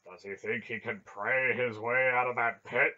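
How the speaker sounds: loud, disgusted question. A narrator's reading. Barak doubting that prayer frees anyone, disgusted. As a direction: scoff loudly at the very idea. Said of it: "Beric sounded disgusted".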